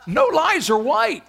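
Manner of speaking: high, loud, laughing through the line; slow, laughing restatement; laughing punchline. Real, not acted.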